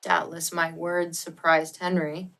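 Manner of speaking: ordinary delivery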